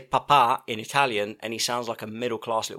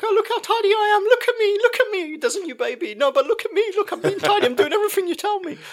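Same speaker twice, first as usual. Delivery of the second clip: put-on much higher, louder, squeaky little-kid voice; mock toddler voice; 5 dB louder than usual. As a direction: show off like a smug toddler. Mocking a toddler showing off.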